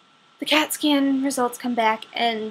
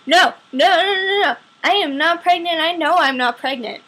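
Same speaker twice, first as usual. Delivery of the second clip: loud, rapid, insistent no's; frantic denial; 6 semitones higher, 7 dB louder than usual. Retold or imitated. Daughter denying the pregnancy to the doctor: loud, frantic insistence. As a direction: shout down the idea, louder and higher.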